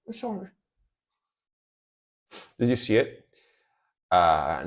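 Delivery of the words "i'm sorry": scared apology. Retold or imitated. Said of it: "And he goes like".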